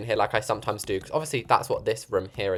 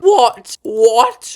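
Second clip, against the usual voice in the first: high loud disbelieving cry; shocked; 10 semitones higher, 10 dB louder than usual. Real, not acted. Builder cries out when the lamp won't place. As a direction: yelp it out in disbelief.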